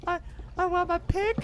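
whiny mocking voice. Mocking friend as whiny partner.

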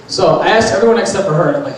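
usual speaking voice

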